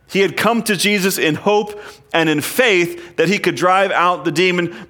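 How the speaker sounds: ordinary talk, nothing marked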